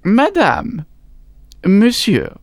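a French doctor's formal greeting; French accent. A narrator's reading. French doctor addresses couple.

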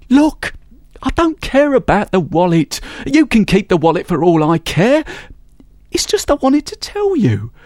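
high, persuasive, flirting pitch; a thief wooing a rival thief; charming thief. Acted. Thief woos the woman thief.

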